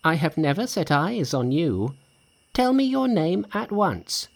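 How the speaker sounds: high-pitched, demanding monkey; defiant Monkey. A narrator's reading. Monkey challenges the spirit.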